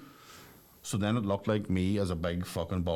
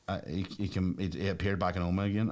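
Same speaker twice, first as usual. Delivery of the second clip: ordinary delivery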